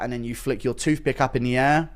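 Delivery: normal talking voice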